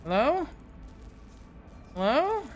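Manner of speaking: usual speaking voice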